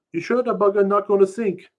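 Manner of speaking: high, loud worried pidgin question; doubtful pidgin. Acted. Narrator asks Sweetie if the canoe will sink, high pidgin.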